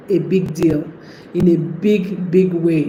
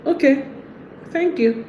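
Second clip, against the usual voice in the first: dismissive; 7 semitones higher, 5 dB quieter than usual. Retold or imitated. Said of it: "the wrong response ... is when you say".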